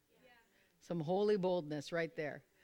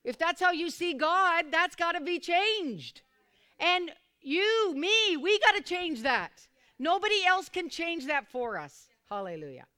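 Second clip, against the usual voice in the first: raised, ringing preacher's voice; fervent preaching; 8 semitones higher, 8 dB louder than usual. Acted. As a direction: preach it up high and loud to the congregation. Preacher builds to fervor.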